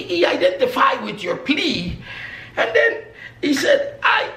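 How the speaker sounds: loud, high, fast-building preacher's tale; fiery sermon storytelling. Acted. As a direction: drive the story hard to the congregation. Preacher acts out speeding story.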